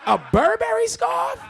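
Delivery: hammed-up exasperated dad; outraged disbelief. Real, not acted. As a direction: squeal it in shocked outrage. Furious dad about scarf.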